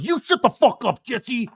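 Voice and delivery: high-pitched imitation of firing back; acted-out quarrel comeback; retold angry retort. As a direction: throw it back in mock fury. Acting Luke shouting back at Jesse.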